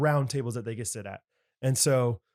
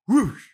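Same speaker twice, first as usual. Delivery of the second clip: whoosh sound; 7 semitones higher, 6 dB louder than usual. Shown as a demonstration. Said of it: "Love the whoosh".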